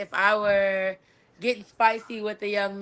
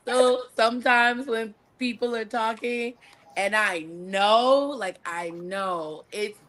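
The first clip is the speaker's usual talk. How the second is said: usual speaking voice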